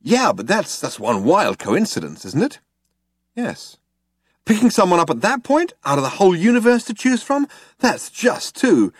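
high, animated disbelief; excitable Zaphod, incredulous. A narrator's reading. Zaphod argues with Trillian about the coincidence, incredulous. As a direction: protest the coincidence, pitch soaring.

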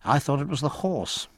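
mildly cold, slightly put-on lady's voice; understated cold remark; coolly dismissive. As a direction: answer with cool composure. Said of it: "said Diana coldly".

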